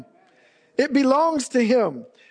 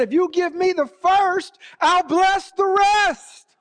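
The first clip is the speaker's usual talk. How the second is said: God's promise; 9 semitones higher, slower than usual. Retold or imitated. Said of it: "And he said".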